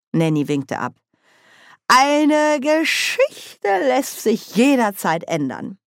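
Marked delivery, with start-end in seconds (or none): 1.9-5.8 high, slow, drawn-out retort; unbekümmerter Widerspruch. A narrator's reading. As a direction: wave the objection away, stretching the words. Nanny widerspricht Oma unbekümmert. (hoch und gedehnt widersprechend)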